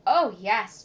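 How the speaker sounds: a burst of loud eagerness; eager assurance. Acted. Boy assuring his father he loves candy, loud and eager. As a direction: blurt it out, keen to agree. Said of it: "John assured him".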